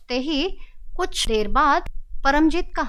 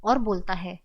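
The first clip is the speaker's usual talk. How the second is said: ordinary delivery, nothing marked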